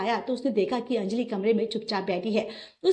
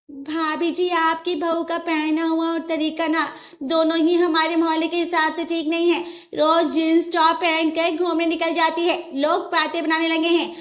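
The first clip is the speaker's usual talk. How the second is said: higher, louder busybody complaining; tut-tutting about the new bride, pitched up; nosy neighbour; 6 semitones higher, 4 dB louder than usual. Acted.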